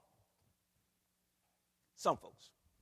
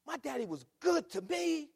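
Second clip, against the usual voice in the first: louder realization; 8 semitones higher, 6 dB louder than usual. Shown as a demonstration.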